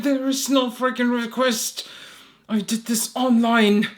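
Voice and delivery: high, loud, insistent complaint; exasperated protest. Retold or imitated. Caller insisting to the agent about the request, exasperated. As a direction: hammer it out in frustration, slowing at the end.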